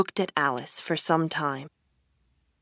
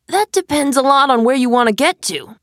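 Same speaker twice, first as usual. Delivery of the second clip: higher, louder cat character voice; Cheshire Cat; 8 semitones higher, 8 dB louder than usual. A narrator's reading. Cat answering lost Alice, raised and loud. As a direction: answer as a knowing cat, pitched up. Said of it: "said the cat".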